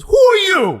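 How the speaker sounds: high, loud, sharp demand; a put-on indignant office voice; suspicious receptionist. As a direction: snap it back at the caller suspiciously. Suspicious woman on the phone.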